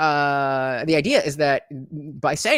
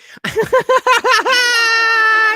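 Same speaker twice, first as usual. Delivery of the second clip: loud shrieking laugh; 9 dB louder than usual. Real, not acted. Bursts out laughing.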